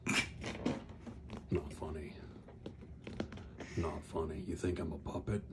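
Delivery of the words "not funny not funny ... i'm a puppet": lower, much softer grumble; quiet sulk. Acted. Offended puppet grumbling quietly to Damien. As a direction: mutter it, hurt.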